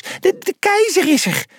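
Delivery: high, stammering mouse squeak; frantic tiny mouse voice. A narrator's reading. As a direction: stammer out the warning in a panicky squeak. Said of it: "piepte Pliny".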